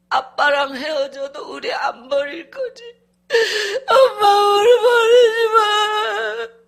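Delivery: put-on high pleading child's wail, loud and drawn out; child crying. Son begs his mother not to leave him, wailing. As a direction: beg through sobs, stretching the words. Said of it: "애가 계속 울어".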